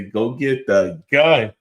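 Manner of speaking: drawn-out, slow mocking repeat; lazy mock quote of the son. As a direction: stretch it out slowly, mocking the son. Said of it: "my man say".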